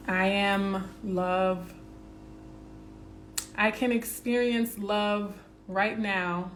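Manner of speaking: slow, earnest affirmation read. Acted. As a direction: savour each phrase like a mantra. Reads affirmations over music.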